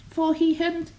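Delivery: ordinary delivery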